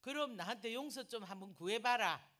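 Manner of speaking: loud and high challenge; firm demand. Retold or imitated. Telling the brother to ask forgiveness, loud. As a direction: lay down the demand firmly.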